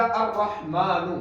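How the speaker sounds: अर्रहमानु said slowly with a clear a on the alif; slow teacher's demo of अर्रहमानु; Arabic pronunciation. Shown as a demonstration. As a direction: spell out अर्रहमानु slowly, syllable by syllable. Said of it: "अलिफ पर हमने जबर लगा दिया".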